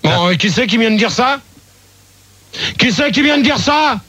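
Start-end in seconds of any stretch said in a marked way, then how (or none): 2.8-4.0 higher, insistent repeat of the question; real irritated challenge; angry demand. Real, not acted. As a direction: demand to know who spoke, voice raised in pitch. Host repeats demand, angrier.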